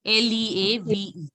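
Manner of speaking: ordinary talk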